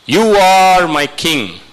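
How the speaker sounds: loud, high, drawn-out proclamation; ringing cry of worship; exalting declaration. Acted.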